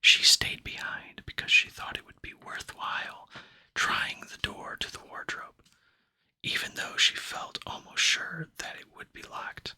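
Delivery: ordinary delivery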